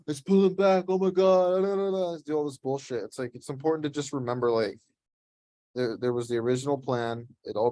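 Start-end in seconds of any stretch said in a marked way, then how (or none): 0.0-2.2 high, loud panicked babble; hammed-up panic over a pullback; mock panicking trader. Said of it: "What a lot of people will start doing is be like".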